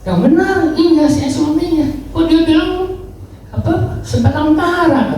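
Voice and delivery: high-pitched, taken-aback reply; shocked retort. Retold or imitated. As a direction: act out a startled man's disbelief. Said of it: "Dia kaget".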